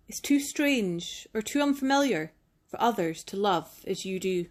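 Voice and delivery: ordinary delivery, nothing marked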